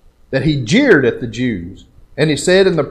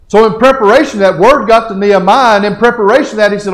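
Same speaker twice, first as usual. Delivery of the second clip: raised, urgent pulpit questioning; forceful preaching; 7 semitones higher, 7 dB louder than usual. Real, not acted. Preacher asking the church about bitterness, forceful preaching. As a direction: press the question on them, loud and high.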